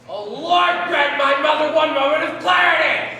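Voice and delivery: high, very loud cry to heaven; exasperated loud prayer. Acted. Child praying over a forgetful mother, exasperated shout. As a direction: wail it out as a desperate plea.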